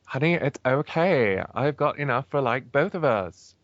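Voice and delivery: put-on lightly done Valley Girl voice; bubbly Valley Girl reassurance; Valley Girl accent. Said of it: "Some days you're a Valley Girl".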